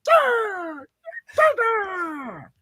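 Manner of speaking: high, cracking joke shout; mock rally yell. Host hams up a rally cry to cohost. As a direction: yell it like a crazed campaign rally.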